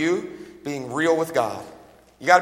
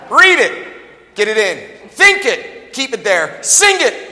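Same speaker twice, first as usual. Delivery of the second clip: preaching shout; 4 semitones higher, 6 dB louder, much slower than usual. Real, not acted. Preacher chanting his mnemonic.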